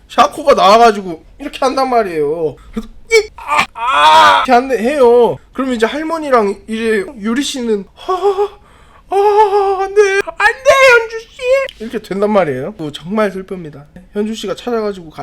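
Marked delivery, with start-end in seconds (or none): 3.1-4.4 short cries building louder; high pained yelps, last one loud; acted scream. Victim of the masked attacker, acted pained screams. Acts out the stabbing. 8.1-11.3 high, drawn-out wail of dismay; gasp, then wail in mock horror; acted horrified cry. Yuri seeing her friend killed, high horrified wailing. Voices horrified onlookers at the death.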